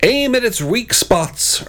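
high, drawn-out urgent order; storybook battle urging. A narrator's reading. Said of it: "urged the Red Knight".

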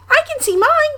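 put-on very high squeaky little child voice; excited Roo cry. A narrator's reading. Roo spots his stick in the river, excited squeal. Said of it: "cried Roo".

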